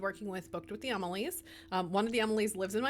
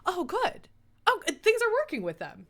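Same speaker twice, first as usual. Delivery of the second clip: high, pleased oh, good; imitating a happy reader's relief; delighted relief; 10 semitones higher, 5 dB louder than usual. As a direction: rise up bright with relief.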